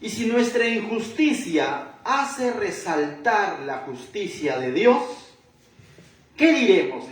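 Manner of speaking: a light voicing of Paul asking the crowd; questioning as Paul. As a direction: pose the objection aloud, drawn out. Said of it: "Aquí estoy hablando como hombre".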